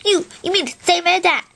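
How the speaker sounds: a hint of an odd character asking in disbelief; Cauliflower voice. Original character Cauliflower questioning someone, lightly voiced disbelief. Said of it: "I can do my original character, Cauliflower".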